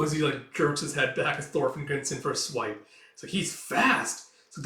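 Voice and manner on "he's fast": amazed onlooker. Acted.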